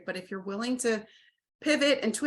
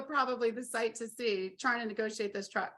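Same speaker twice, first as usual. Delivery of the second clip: usual speaking voice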